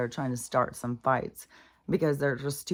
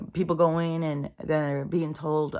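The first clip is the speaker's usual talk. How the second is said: usual speaking voice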